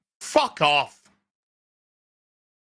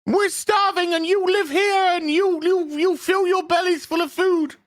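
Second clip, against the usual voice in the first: high, pleading beggar's rant; hammed-up angry peasant; mock peasant rant; 9 semitones higher than usual. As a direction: whine it like a starving man. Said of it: "He's just like".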